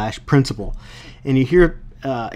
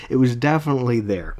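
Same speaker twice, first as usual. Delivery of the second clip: ordinary talk, nothing marked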